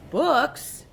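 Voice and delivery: high puzzled pirate question; confused. A narrator's reading. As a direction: sound baffled by the word. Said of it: "Braidbeard looked confused".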